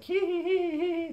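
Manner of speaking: very high, loud cartoon giggle; mock giggle. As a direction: giggle like a mischievous kid. Gleeful giggle in retold prank.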